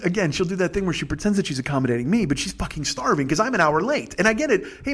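usual speaking voice

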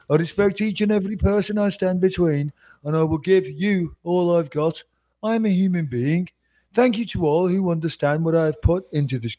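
reading it in a Brummie accent; Birmingham accent. Shown as a demonstration. Reading the referee's statement aloud in a Brummie accent. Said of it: "they talk like this".

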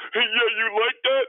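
very high, loud crowing; hammed-up trash talk, shouted high; mock cocky taunt. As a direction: crow it at a beaten defender. Retold taunt after catching pass.

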